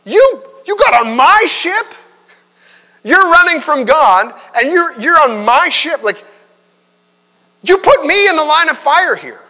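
outraged sailor. Retold or imitated. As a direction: shout it in furious disbelief at the stowaway.

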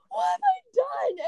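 very high, slow, horrified gasp of a line; mock horror. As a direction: act out the shock of hitting a teammate. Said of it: "And I'm like".

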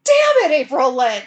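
loud, high furious outburst; angry yell. Retold or imitated. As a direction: blow up at being tricked. Grandpa furious at spiked drink.

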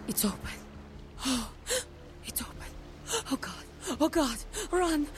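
hushed dread building to a cry; from a frozen hush to a frantic warning; rising panic. Acted. Seer panics at her vision.

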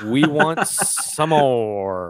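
slow, drawn-out arena announcer. Pretend. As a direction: stretch it out like a ring announcer. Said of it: "you have to talk in your announcer voice".

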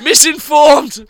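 slow, loud, high mocking cry; hammed-up outraged yell; mock conspiracy rant. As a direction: shout it like a ranting truther.

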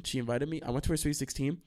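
ordinary delivery

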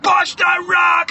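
slow, loud, high bellow; hammed-up trainer's drawn-out yell; urgent coaching shout. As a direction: hammer each word at the struggling athlete. Trainer urging Moana to push.

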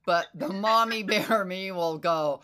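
ordinary talk

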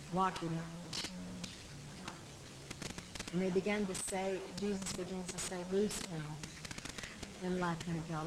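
soft, low narration; hushed storytelling. A narrator's reading. Preacher telling how Lazarus walks out of the tomb, hushed. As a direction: draw them in quietly to the miracle.

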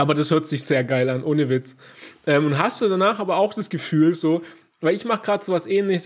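ordinary talk, nothing marked

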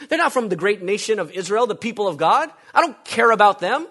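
fiery preaching. Acted. Preacher thundering to the congregation about Jonah's selfishness. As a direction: preach it hard, building conviction.

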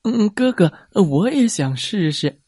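high, eager little brother; a small boy pleading to join in. A narrator's reading. Little brother asking big brother, eager and high.

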